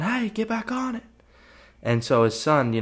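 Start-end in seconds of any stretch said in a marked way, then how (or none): 0.0-1.0 a lightly hammed-up father barking at his son, pitched way up; retelling Inky calling his son back onto the four-wheeler, much higher mock voice; mock dad yell. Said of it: "Inky yells at his son".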